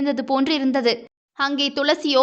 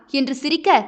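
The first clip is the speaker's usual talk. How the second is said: ordinary delivery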